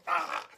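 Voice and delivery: high sharp scream of pain; shocked scream. Retold or imitated. Brother shocked by sister's experiment, high pained scream. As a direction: scream as the current shoots in. Stove and toaster electric shock.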